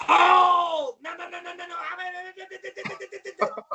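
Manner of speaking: high yell into rapid stammered noes; Mike Sharp. Retold or imitated. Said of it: "All the yelling, all the, that's why".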